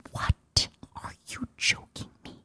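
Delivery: whispered disbelief; stunned whisper. Retold or imitated. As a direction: whisper it as if too shocked for voice. Stunned reply to the job offer.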